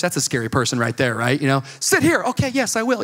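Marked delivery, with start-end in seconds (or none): 1.8-2.2 high, sharp usher's order; mock-angry church usher. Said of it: "an angry usher in church". 2.2-3.0 high, hurried compliance; mock-nervous agreeing. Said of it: "you get very nervous".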